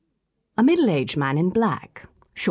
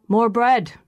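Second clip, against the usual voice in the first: "More bread" called out loud and high; rough demanding shout at the table; loud cry; 6 semitones higher, 5 dB louder than usual. A narrator's reading. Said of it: "cries Mister Malone".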